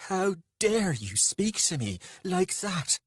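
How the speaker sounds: high, slow, furious outrage; trembling with rage. A narrator's reading. Lover rebukes Beatrix after the slap, enraged. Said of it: "trembling with rage".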